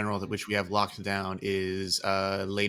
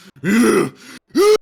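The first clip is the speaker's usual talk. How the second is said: high, very loud mock screams; imitated rage yell; 12 semitones higher, 16 dB louder than usual. Raging on a friend's behalf, imitated yelling. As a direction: let out bursts of pretend raging. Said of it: "that was really accurate".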